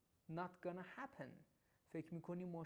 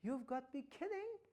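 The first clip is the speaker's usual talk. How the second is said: surprised disbelief; 6 semitones higher, slower than usual. Shown as a demonstration. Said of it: "حالت عصبانی شدن و هم تو حالت شگفتی".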